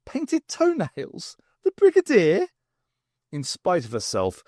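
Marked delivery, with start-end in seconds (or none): 0.1-2.5 slow, much higher, incredulous; amused disbelief. Acted. Sarah picturing the Brigadier's toenails, slow amused disbelief. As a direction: savour the absurd picture.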